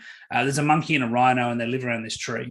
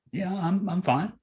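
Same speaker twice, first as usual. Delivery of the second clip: squirrel voice; 6 semitones higher, 4 dB quieter than usual. Acted.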